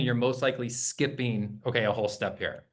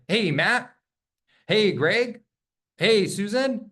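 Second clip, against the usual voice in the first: put-on bright higher hey, like an old friend; familiar friendly greeting; 7 semitones higher, 4 dB louder, slower than usual. Shown as a demonstration. Agent greeting a lead like an old friend, bright and familiar. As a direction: greet them like they know you. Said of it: "the tone and the energy that I'm using".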